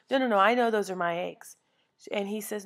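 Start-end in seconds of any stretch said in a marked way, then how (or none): 0.1-1.6 mock indignant customer. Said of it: "She goes".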